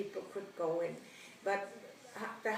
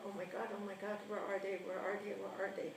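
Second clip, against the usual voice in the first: scared whisper-like panic; 2 semitones lower, 6 dB quieter, much faster than usual. Retold or imitated. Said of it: "And I was so scared".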